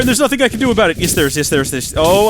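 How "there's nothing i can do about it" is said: higher, rushed despairing cry; frantic helpless exclamation; panicky wail. Real, not acted. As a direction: blurt it out in panic. Panic while burning.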